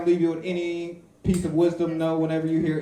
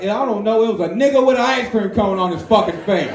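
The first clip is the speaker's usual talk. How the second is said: higher, louder mock robbery victim; 7 semitones higher, 8 dB louder than usual. Comic telling his crowd what the victim says, in a higher, louder mock voice. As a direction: play the robbed guy, shaken and loud.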